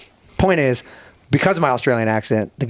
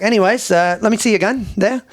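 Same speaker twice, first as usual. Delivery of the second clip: higher-pitched, casual Aussie impression; Australian accent; 8 semitones higher than usual. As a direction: ask offhandedly as an Australian bloke. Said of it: "Pretty, pretty good Australian accent".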